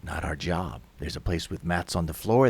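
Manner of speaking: low, soft, impassive worker's voice; indifferent official. Acted. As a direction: dismiss them in a low, unmoved voice. Indifferent movers answer.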